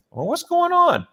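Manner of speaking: very high-pitched groggy confusion; waking up bewildered. Retold or imitated. Patient waking to doctors laughing at him, bewildered. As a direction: squeak it out as if just coming to. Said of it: "I'm trying to wake up".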